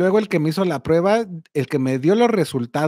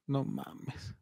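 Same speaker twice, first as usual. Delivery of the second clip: barely audible retold aside; dismayed whisper; 4 semitones lower, 19 dB quieter than usual. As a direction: whisper it to yourself in disbelief. Dreading aside during nurse's questions. (susurro apenas audible, consternado)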